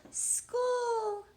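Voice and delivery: high, gleeful shout; excited shout. Acted. Girl running to school, high gleeful cry. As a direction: sing out the word with joy. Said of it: "said Chrysanthemum".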